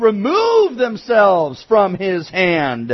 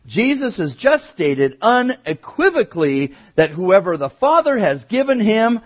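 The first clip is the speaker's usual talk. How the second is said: ordinary talk, nothing marked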